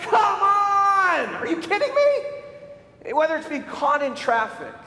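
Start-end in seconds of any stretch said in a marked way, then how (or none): 0.0-1.3 high, loud, drawn-out cry of exasperation; exasperated shout. Acted. Preacher acts losing patience. 1.4-3.1 high, slow, stretched-out disbelief; exasperated outcry. Acted. Acted exasperated outburst.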